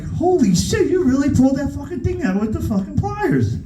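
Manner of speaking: high, stunned exclamation; appalled, voice jumping high; shocked disbelief. Retold or imitated. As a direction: pitch up in horrified amazement. Seeing tooth pulled with pliers.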